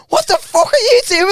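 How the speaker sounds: high-pitched mock outrage; incredulous high-voiced quote; alarmed retort. Retelling someone's alarmed reaction.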